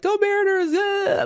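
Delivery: high, drawn-out team cheer; piercingly high mock cheering; annoying fan cheer. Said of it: "like, being annoying".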